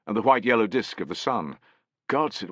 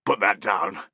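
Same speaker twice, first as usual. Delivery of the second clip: squawked; 3 dB louder than usual. A narrator's reading. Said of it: "squawked Rheumy Eyes".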